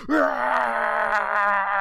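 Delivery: long, high, loud mock scream; drawn-out hammed-up power scream; face-off scream. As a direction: imitate two fighters psyching each other up. Imitates both screaming face to face.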